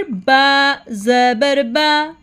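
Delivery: "बा ज़बर बा" very slow, vowels stretched; spelling-out drill. Shown as a demonstration. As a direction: recite "बा ज़बर बा" as a drawn-out drill. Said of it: "letter के ऊपर पढ़ना है". ("बा ज़बर बा" खींचकर, धीरे पढ़ें)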